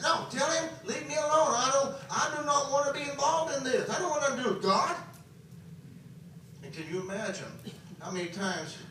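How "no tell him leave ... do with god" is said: higher, insistent retold refusal; defiant attitude. Son tells his crying mama he wants nothing of God, high and defiant. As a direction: act out a son's stubborn protest. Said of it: "But I had an attitude".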